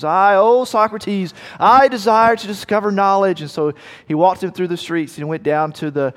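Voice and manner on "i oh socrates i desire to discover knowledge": put-on higher, louder, overblown young seeker; pompous young man. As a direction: play him as a puffed-up fool begging the master. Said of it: "this kind of pompous numbskull".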